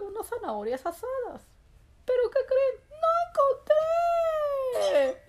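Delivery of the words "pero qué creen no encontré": high, loud wail with a long drawn-out ending; dramatic outcry. Acted. Rabbit tells listeners he found no carrots, dramatic outcry. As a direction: cry out the reveal, stretching the last word.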